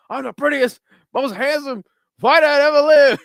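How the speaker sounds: high, loud boxer's taunting yell; imitated yelling. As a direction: mimic Muhammad Ali bellowing a boast. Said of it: "he's fucking yelling out of a bus with a microphone".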